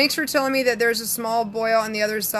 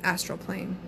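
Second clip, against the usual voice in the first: low, soft guru voice, spaced out with pauses; mock spiritual; 3 semitones lower, 6 dB quieter than usual. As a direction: speak in a hushed, solemn, spiritual-teacher manner. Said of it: "when I talk like this, you'll believe me".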